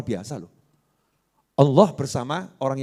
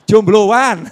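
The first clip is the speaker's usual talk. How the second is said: calling out loud; 5 semitones higher, 12 dB louder than usual. Real, not acted. Calling out to audience.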